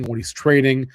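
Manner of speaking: normal talking voice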